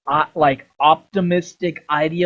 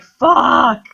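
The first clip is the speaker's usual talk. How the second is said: pained curse; 8 semitones higher, 5 dB louder than usual. Retold or imitated. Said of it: "Connor's like".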